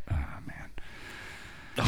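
heavy sigh, barely voiced; dramatic sigh. Real, not acted. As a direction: let out a big weary sigh. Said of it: "That's a big sigh".

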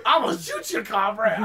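comic spaceman threat; put-on voice, laughter behind it. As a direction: threaten playfully in the silly character voice. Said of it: "Please only talk in the spaceman voice".

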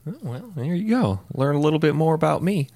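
squeaky high playful voice; jokey high falsetto; high put-on voice.

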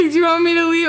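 high, loud tearful whine; mock crying. Imitating herself asking angry ex, mock tearful pleading. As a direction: plead in a hammed-up sob. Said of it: "I'm literally crying".

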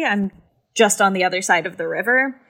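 ordinary talk

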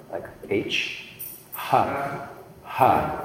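H as pure aspiration; breathy h. Shown as a demonstration. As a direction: push out a breathy H. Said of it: "H, aspiration sound".

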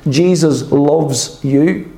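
drawn out slowly, each word weighted; slowed, earnest emphasis; slow emphatic. Retold or imitated. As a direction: press the words home slowly and tenderly. Pastor retells his parting words.

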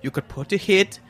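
mock German accent, comfortable in it; put-on German accent. Guest claiming his own accent, German accent. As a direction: play a hammy German character. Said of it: "talking in my own accent".

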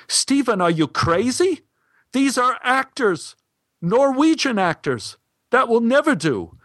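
high, shocked panic; panicked. Acted. Kari panics at the wine order, high and alarmed. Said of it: "Kari turned pale and started to panic".

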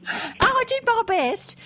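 very high, fruity mock British; fake British accent. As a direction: play it up in a put-on British voice. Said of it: "burst into a sort of fruity, fake British accent".

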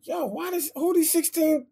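hyped, high-pitched disbelief. Retold or imitated. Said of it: "I sound hyped because I'm like".

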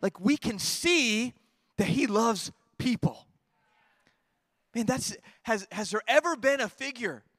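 slow, high, rising preacher's plea; impassioned preaching. Acted.

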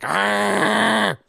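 drawn-out imitated "argh" of annoyance; exasperated growl. Cohost imitating a drink runner who hated being yelled at, exasperated. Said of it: "who just hated being yelled at".

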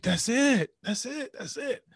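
high-pitched, dawning revelation; retold eureka moment; excited realization.

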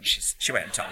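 usual speaking voice

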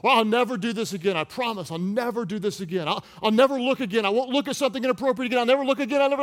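high, loud, quickening vows; frantic promising. Acted. Sinner vowing to God never to sin, frantic promising. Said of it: "And right after you promise".

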